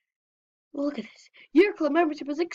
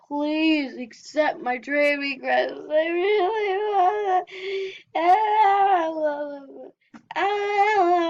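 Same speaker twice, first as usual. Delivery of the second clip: put-on baby crying; 5 semitones higher, much slower than usual. Player begging to be traded with, mock baby crying. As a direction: fake baby wail, slow and drawn out. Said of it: "that baby crying sound".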